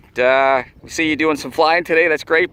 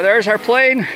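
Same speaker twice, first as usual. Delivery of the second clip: excited; 8 semitones higher, 4 dB louder than usual. Real, not acted. Plane found after long search.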